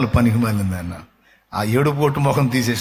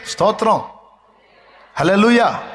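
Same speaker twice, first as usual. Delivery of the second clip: raised, high, full-force preaching voice; fiery preaching; 7 semitones higher than usual. Acted. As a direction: pastor thundering to his church.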